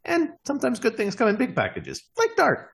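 high, perky little gnome voice; small gnome hostess chatting away; chatty gnome. Acted. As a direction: muse aloud in a high-pitched voice. Plays gnome Sarah.

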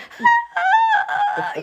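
mock screechy high note. Shown as a demonstration. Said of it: "it's gonna be like".

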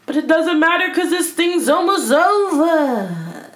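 high, loud singsong, swooping up; voice changing. Real, not acted. Podcaster running out of laptop battery, voice swinging high. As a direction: let the voice jump high and swoop about. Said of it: "my voice is, like, changing".

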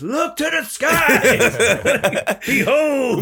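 very high, loud, very slow booming cry; grand prophet proclamation. Retold or imitated. Hustler priest to the crowd, booming slow proclamation. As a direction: proclaim like a false prophet at the eclipse. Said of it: "And he goes".

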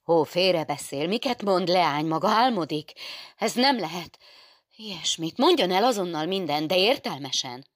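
loud, urgent demanding nun; stern nun shouting at the frightened girl; agitated nun. Acted. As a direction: press her for the truth, alarmed. Alarmed nun presses the girl.

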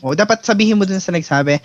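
ordinary delivery

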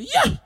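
very high loud "Ia!" cry; involuntary tic shout; sharp yelp. Retold or imitated. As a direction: yelp it out suddenly. Tourette outburst mimicked.

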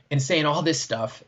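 usual speaking voice

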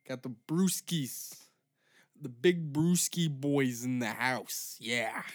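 lightly voiced party bro, slow; a hint of a beer-bro hype voice. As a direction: hype up the brewskis like a frat guy. Bro voice about beers.

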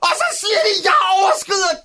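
high, indignant shouting; furious outburst. Acted. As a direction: shout in wounded, melodramatic outrage. Acted furious outburst at interviewer. (rasende, forurettet råben)

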